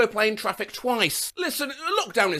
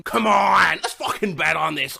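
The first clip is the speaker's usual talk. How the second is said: Adam Sandler. Retold or imitated. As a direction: burst into a hammed-up comedian impression. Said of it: "an impression of Adam Sandler going".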